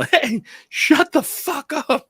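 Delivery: high, slow, stretched-out mock yell; imitated angry yell. Scooter rider to the screaming man, replayed yell. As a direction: holler it as a comic replay.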